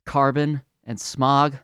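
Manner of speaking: normal talking voice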